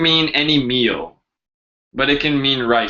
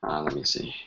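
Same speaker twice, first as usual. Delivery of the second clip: ordinary delivery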